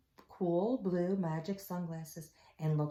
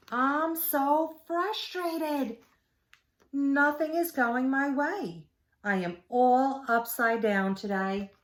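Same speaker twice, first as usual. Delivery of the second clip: higher, slow, whiny turtle voice; frustrated turtle; 8 semitones higher, 5 dB louder, slower than usual. Acted. Turtle complaining to Pete, frustrated. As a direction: whine it out, fed up. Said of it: "Turtle did not look happy".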